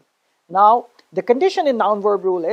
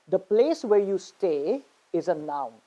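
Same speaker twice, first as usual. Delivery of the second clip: normal talking voice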